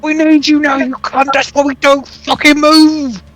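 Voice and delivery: high, exasperated snarl; angry. Pretend. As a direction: scold him with high-pitched fury. Character scolding companion.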